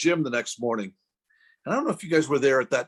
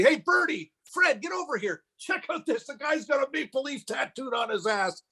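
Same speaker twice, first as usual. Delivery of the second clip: calling out; 11 semitones higher, 3 dB quieter than usual. Retold or imitated. Doctor calling buddies over to gawk, high mock holler. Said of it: "he called over his buddy".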